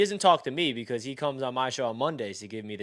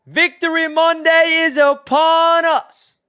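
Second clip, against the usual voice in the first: high, loud, slow booming proclamation; hype announcer; 11 dB louder, slower than usual. Retold or imitated. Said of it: "he brought that energy".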